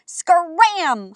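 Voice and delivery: loud shooing holler; a firm holler at the birds; hollering grandma. A narrator's reading. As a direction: chase the birds off with a shout. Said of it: "hollered Grandma".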